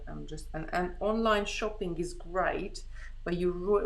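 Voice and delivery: usual speaking voice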